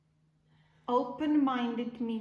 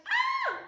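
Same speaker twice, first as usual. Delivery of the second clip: sudden high startled shriek; frightened scream; 7 dB louder than usual. Shown as a demonstration. Teacher shows kids a startled "Ah!". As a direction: scream as if a balloon just popped. Acting out fright at balloon pop.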